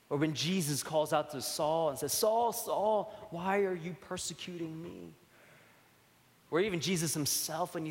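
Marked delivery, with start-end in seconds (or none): 2.1-5.1 pleading call. Retold or imitated. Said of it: "with great pathos".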